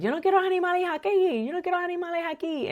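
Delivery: high, rapid mock complaint in Spanish; complaining mom. Mom grumbles about the animals her son brings, mock complaining. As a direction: imitate mom's fast, high-pitched griping. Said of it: "then she complains about it".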